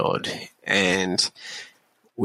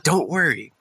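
higher, upbeat reassurance; super happy; 7 semitones higher than usual. Retold or imitated. Sergeant reassuring a panicked soldier before the board, super happy. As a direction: imitate an over-excited boss cheering you on. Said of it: "He's, like, super happy".

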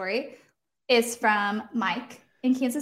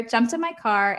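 usual speaking voice

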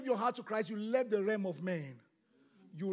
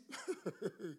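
soft laugh; 2 semitones lower, 8 dB quieter than usual. Real, not acted. Preacher laughs at mocked doubter.

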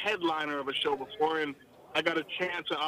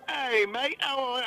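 higher-pitched, amateur Australian imitation; attempted Australian accent; 7 semitones higher than usual. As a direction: have a go at an Australian greeting. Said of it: "I can't do the accent".

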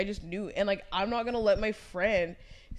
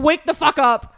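screaming at a friend to wake up; unhinged shout; 8 semitones higher, 15 dB louder than usual. Retold or imitated. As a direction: yell it to snap her awake. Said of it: "I was like".

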